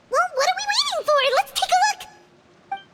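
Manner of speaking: can't wait to grab the list; excited to see the list; eager. Acted.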